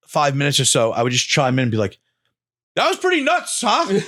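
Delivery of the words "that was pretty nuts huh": much higher, casual as if nothing happened; breezy shrug of a remark; dazed understatement. Retold or imitated. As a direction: toss it off lightly, pitched way up. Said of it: "you were processing it".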